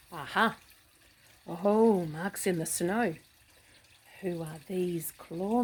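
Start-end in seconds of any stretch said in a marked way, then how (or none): none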